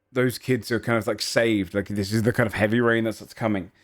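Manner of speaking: ordinary talk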